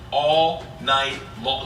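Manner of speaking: very slow "All night long"; "All night long" word by word, dragged out; drawn-out emphasis. Real, not acted. Repeats the phrase for emphasis.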